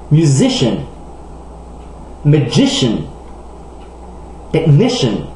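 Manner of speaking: "Education" to "Technician", each stressed just before the final "shun" sound; second-to-last syllable stress. Shown as a demonstration. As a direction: punch the penultimate syllable, as in "Education", "Musician". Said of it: "sont habituellement accentués sur l'avant-dernière syllabe". (de « Education » à « Technician », accent sur l'avant-dernière syllabe)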